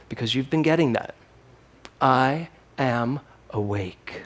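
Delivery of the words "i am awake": each word slow and weighty; slow solemn declaration. Real, not acted. Minister revealing the year's three words, slow and solemn. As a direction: lay down three words, slowly. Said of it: "Three words".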